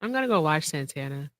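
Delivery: usual speaking voice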